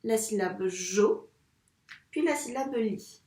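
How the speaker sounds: slow, each syllable set apart; slow segmenting. Shown as a demonstration. Teacher segmenting joli for the pupil, slowly. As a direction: lay out jo and li one at a time. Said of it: "en segmentant les syllabes".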